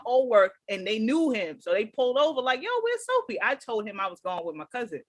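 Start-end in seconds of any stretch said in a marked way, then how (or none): 2.6-3.4 calling out. Retold or imitated. Guys calling out from car.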